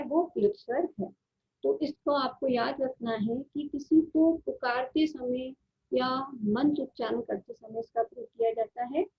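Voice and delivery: ordinary talk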